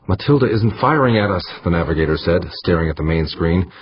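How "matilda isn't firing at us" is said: higher, agitated insistence; urgent protest. A narrator's reading. Navigator to captain while under fire, high urgent protest. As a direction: protest it with pitch raised.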